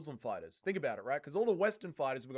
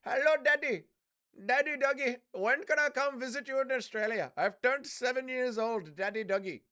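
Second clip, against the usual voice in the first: put-on little kid voice; 8 semitones higher, 4 dB louder than usual. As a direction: play a small child calling for his dad. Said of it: "Impression of Dougie having a kid".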